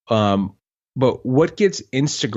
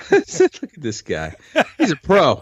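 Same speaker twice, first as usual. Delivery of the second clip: laughing; 6 semitones higher than usual. Real, not acted. Teasing co-host, laughing.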